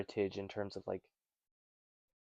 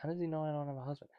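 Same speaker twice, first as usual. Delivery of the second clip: quick, puzzled aside; bewildered; 5 semitones higher, 6 dB quieter, much faster than usual. Retold or imitated. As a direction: wonder aloud, rushing. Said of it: "she could either be like".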